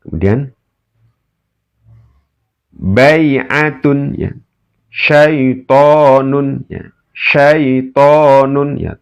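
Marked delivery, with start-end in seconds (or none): 2.7-9.0 "Syaitonun" slow, the o drawn out long; tajwid drill. Shown as a demonstration. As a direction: stretch the "to" in "Syaitonun". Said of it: "di ayun karena mato bi".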